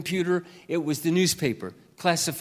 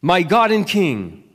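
slow, louder proclaiming psalm read; scripture reading; 3 semitones higher, 9 dB louder than usual. Acted. Pastor reading Psalm 145 aloud to the church, slow and proclaiming. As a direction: lift each line up as praise.

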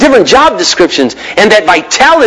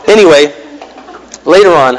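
ordinary delivery

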